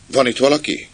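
high, loud call into the dark. Acted. Jimmy groping through the dark hold, calling out. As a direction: call out to see who's there. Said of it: "Kiáltotta".

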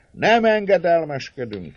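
magas, hangos bekiabálás; kiáltsd vissza dacosan a tömegből; tömeges tiltakozó kiáltás. A narrator's reading. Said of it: "kiáltta sok hang". (tiltakozva felkiáltva)

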